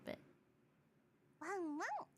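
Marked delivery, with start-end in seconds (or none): 1.4-2.1 squeaky excited thank-you; high, cute gratitude; high-pitched thanks. Real, not acted.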